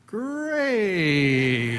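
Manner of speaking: drawn-out, dreading "Great"; sarcastic "Great" in his head; inner groan. Retold or imitated. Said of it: "And in my heart, I said".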